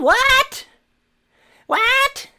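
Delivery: outraged high-pitched what; incredulous shriek. Real, not acted. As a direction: shriek it in disbelief. Incredulous rant.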